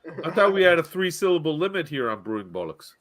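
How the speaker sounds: normal talking voice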